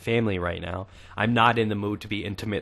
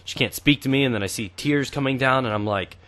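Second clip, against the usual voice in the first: ordinary talk, nothing marked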